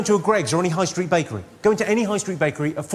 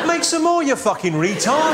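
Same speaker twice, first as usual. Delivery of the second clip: mock outrage; 8 semitones higher than usual. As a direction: blow up at the useless bakery staff. Ranting at bakery staff.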